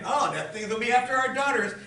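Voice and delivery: high, loud mock alarm; kidding. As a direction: exaggerate the alarm as a joke. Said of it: "I'm pretty sure he's kidding".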